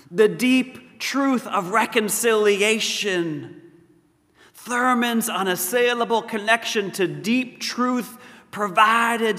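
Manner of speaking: high, ringing sermon cadence; emphatic preaching. Real, not acted. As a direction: preach it with measured, building force, phrase by phrase. Preaches with rising force.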